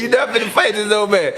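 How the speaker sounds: retelling grandma while cracking up; laughing grandma quote. Retold or imitated. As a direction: giggle out the retold scolding. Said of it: "She was like".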